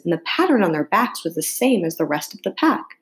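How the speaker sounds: ordinary delivery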